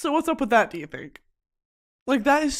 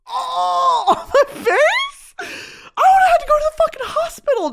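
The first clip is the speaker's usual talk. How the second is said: hammed-up shrieking; 6 dB louder than usual. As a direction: shriek it in mock horror. Imagining the worst burn.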